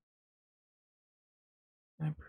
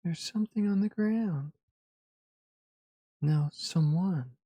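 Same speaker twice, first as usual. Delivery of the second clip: alarmed discovery; 5 dB louder than usual. A narrator's reading. Narrator finding a body in the passage, alarmed. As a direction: raise the voice at a dawning sight.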